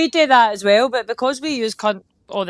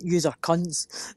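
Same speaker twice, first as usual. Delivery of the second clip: slow, low, quieter mock insult; imagined insult; 4 semitones lower, 7 dB quieter, much slower than usual. Retelling how it must have sounded, slow mock insult. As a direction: drop it slowly, like a verdict. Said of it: "it must have sounded as if I went".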